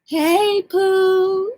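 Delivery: high sing-song hey Pooh; Foodie's signature greeting. Retold or imitated. Showing viewers the streamer's signature hello, high. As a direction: lilt it up like a welcome. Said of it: "That's her signature".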